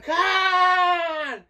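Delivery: long, high, drawn-out shout; crowd yelling. Retold or imitated. Storyteller acts out fans urging on a drunken Scotsman, yelling. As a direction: holler it out like a cheering crowd. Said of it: "they're all yelling".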